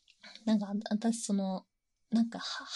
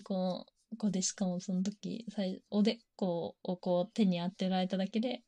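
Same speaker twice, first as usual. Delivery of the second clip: ordinary talk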